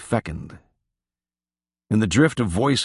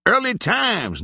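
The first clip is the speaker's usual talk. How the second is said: much higher, mock cry of the brand name; cried out; slower than usual. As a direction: call it out high, teasing. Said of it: "cried J-Bone".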